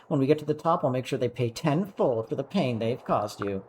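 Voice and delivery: high, cooing, adoring; smothering affection in a high voice; doting big sister. Acted. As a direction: gush over a beloved little sibling.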